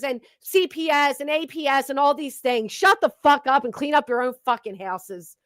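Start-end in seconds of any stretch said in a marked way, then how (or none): none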